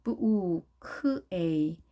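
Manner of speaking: "부우, 크에이" slowly split into b, oo, k, ay; sound-by-sound demo. Shown as a demonstration. Teacher assembling bouquet for learners, slow "부우, 크에이". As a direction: draw out "부우, 크에이" one sound at a time. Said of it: "발음은 간단하지만".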